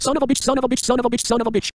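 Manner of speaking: high fast furious ranting; tantrum. Acted. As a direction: throw a screaming tantrum. Tantrum continues.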